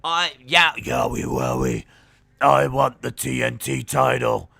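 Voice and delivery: mock wrestling promo, big and loud; Bray impression. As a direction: boom it out like the wrestler crashing the show. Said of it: "He goes".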